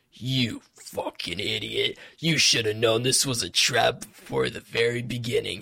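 lightly voiced contemptuous scold from a sidekick; mocking Johnny Silverhand. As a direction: talk down to him like a jaded rocker. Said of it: "He's like".

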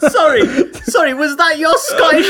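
high-pitched, helpless laughing; cracking up, incredulous; laughing in disbelief. Real, not acted. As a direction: barely get it out through the laughter. Cracking up at the bad accent.

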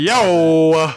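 loud, drawn-out call; loud outcry. Real, not acted. Co-host cutting in on the argument, loud outcry.

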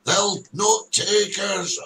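slow mock battle cry; mock Braveheart war cry. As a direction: declaim it slowly like a rebel leader.

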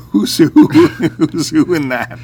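laughing through the question; amused laughing question. Real, not acted. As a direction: ask while cracking up, slowly. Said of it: "laughing while asking".

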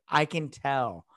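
normal talking voice

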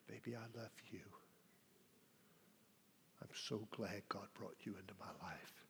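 soft whisper to his wife; husband whispering tenderly to his wife; intimate whisper. Retold or imitated. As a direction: whisper it close, face to face. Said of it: "take her face in my hands".

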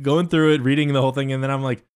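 normal talking voice

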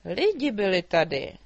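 the drake suddenly wakes up to it; startled dragon. A narrator's reading. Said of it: "zeptal se". (překvapený hlasitý drak)

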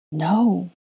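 usual speaking voice

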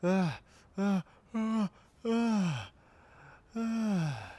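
high rhythmic moans; breathy moans building up; moaning. Acted. Sex scene moaning.

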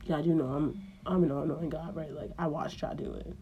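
low deadpan knowing voice; dry God. Acted. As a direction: calmly call the bluff. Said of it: "he looking like".

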